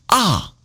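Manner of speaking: AH falling sharply from high; falling fourth tone. Shown as a demonstration. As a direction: drop "ah" steeply from a high start. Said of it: "And fourth tone falling".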